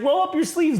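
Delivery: high mock woman giving orders; woman's voice. Woman readying a syringe, high imitated voice. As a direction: pitch it way up, imitating her. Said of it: "She's like".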